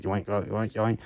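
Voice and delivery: mock yoink. Willie grabbing the pigs.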